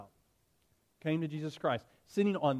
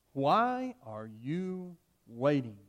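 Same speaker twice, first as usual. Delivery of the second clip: drawn out very slowly, calm and unafraid; slow defiant challenge; much slower than usual. Acted. Polycarp's last words to the proconsul, slow and defiant. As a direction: dare the executioner, slowly. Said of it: "his last words".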